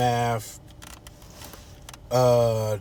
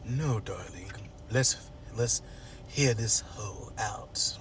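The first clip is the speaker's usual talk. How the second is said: low, hushed bougie voice; soft, slow and haughtily composed; Anita; 3 semitones lower, 8 dB quieter, slower than usual. Retold or imitated. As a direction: bougie lady speaking low and drawn out. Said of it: "that's how they talk, bougie".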